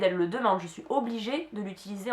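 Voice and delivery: usual speaking voice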